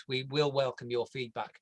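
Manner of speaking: ordinary delivery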